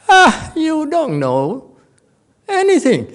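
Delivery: high, slow scornful put-down; dismissive master. Acted. Master dismissing his disciple, high and scornful. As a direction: drag it out with scorn.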